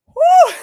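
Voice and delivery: high loud woo into a laugh; relieved whoop and laugh. Real, not acted. Streamer relieved after near phone death, loud whoop then laugh. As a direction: blow off the stress with a whoop.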